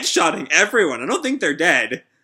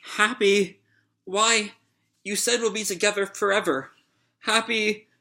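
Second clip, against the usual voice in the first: a touch of tearful grief, higher; mock weeping; 5 semitones higher than usual. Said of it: "like, weeping".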